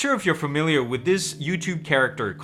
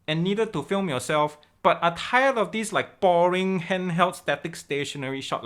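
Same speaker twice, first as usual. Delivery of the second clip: put-on raised-pitch Malaysian English accent; Malaysian Uncle Roger accent; 4 semitones higher than usual. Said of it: "do the intro in that accent".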